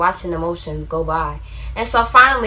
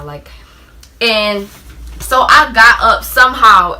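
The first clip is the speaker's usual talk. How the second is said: normal talking voice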